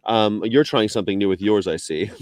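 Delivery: usual speaking voice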